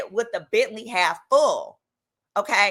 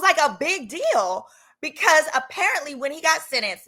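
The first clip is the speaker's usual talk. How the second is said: ordinary delivery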